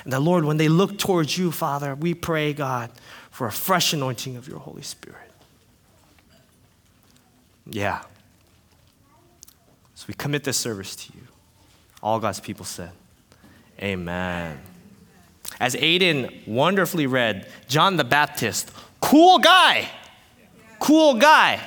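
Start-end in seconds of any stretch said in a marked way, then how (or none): 0.0-14.6 low, soft, reverent prayer; hushed closing prayer, dropping low on the amen. Real, not acted. Leads an opening prayer. 19.0-21.6 high, loud, punched admiration; excited preacher shout-out; hyped praise. Real, not acted. Hyping John the Baptist.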